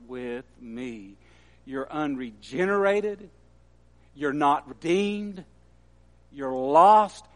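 hushed, measured voice of a master admonishing a disciple; solemn warning. Acted. Voicing Jesus' grave warning to Peter.

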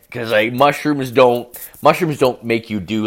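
ordinary talk, nothing marked

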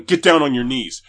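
officer yelling the order with his gun drawn; cop shouting command. Retold or imitated. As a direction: bark it at gunpoint.

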